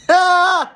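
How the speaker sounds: loud, high burst of laughter; incredulous laugh. Real, not acted. Ranting at a callous parent, a real disbelieving laugh.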